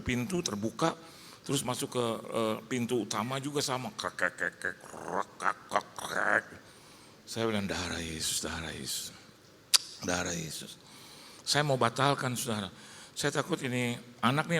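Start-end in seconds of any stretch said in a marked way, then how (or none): 3.9-6.5 creaky door sound. Retold or imitated. Door creaking open, imitated. 7.7-10.7 scared murmur. Retold or imitated. Afraid, pleading the blood.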